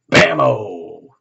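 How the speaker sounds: loud, punchy exclamation; dramatic reveal. Real, not acted. Reader flipping a tarot card for viewers, loud and punchy. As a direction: slam the card down with the word.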